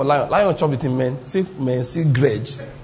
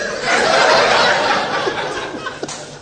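very high airy laughter; wheezing high laugh. Real, not acted. Preacher laughing hard after the story's punchline. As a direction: collapse into breathless laughter.

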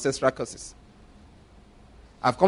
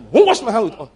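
scoffing laugh; 5 semitones higher, 7 dB louder, faster than usual. Real, not acted.

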